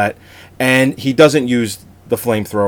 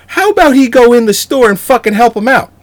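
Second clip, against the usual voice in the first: high, loud outraged yell at the hero; exasperated rant; 12 semitones higher, 6 dB louder than usual. Real, not acted. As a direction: shout your disbelief at the screen. Ranting at the film's hero.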